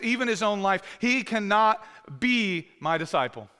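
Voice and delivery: loud, high, booming scripture read; preaching at full force. Acted. Pastor proclaiming Jesus's demand to the congregation, booming. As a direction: thunder out the hard words. Reads Jesus's hard words loudly.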